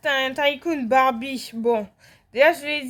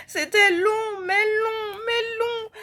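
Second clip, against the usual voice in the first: whiny complaint; 8 semitones higher, slower than usual. Real, not acted. Whining about the endless game.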